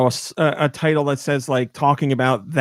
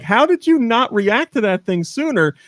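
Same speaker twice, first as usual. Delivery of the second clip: high-pitched incredulous question; cracking up; 10 semitones higher than usual. Retold or imitated. Passengers teasing the driver about the bird, cracking up. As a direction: retell it in amused disbelief. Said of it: "We're cracking up laughing 'cause we're like".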